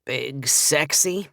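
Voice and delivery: slow, bored drawl on Big Sexy; unimpressed, dragging out Sexy; bored friend unimpressed by the nickname, slow drawl; boring drawl. Acted. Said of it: "in his usual boring drawl".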